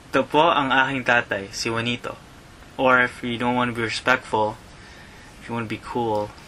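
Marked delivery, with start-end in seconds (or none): 0.1-2.2 quick, fluid "Ito po ang aking tatay, si Juanito"; at normal speed. Shown as a demonstration. Teacher repeating the phrase at normal speed. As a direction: run the phrase together naturally. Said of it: "say it slowly".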